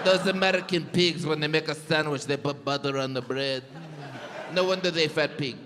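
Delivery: mock European accent, sneering at Americans; heavily put-on European accent; generic European accent. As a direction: caricature a disapproving European. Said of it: "That's a good impression of absolutely every European".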